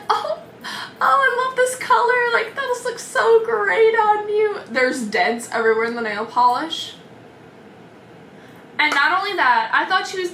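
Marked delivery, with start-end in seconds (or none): 0.1-4.6 squealing, much higher, over-the-top praise; mock the student's fake delight; gushing student. Imitating the student praising botched nails, squeaky gush.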